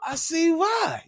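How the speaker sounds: high squeaky yell; high mock shout. Telling why the coach got mad, high squeaky shout. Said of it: "I was like".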